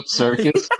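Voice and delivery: ordinary talk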